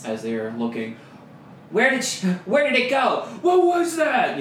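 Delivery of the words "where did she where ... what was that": much higher, louder spooked guard; startled guard. Acted. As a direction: shout at a shadow. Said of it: "that kind of stuff".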